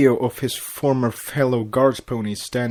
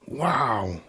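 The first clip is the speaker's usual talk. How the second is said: whispered in awe; breathless sigh; 4 dB quieter than usual. Acted. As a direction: let it out on a breath. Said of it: "he sighed".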